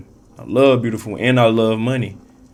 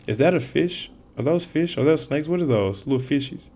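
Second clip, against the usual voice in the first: curious surprise; 4 semitones higher, 4 dB quieter than usual. Real, not acted. Puzzling over card pictures.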